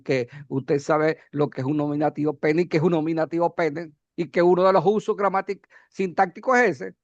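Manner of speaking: normal talking voice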